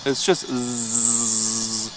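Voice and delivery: zzz drawn out very slowly; long heavy zzz. Shown as a demonstration. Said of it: "There's no B in front of it".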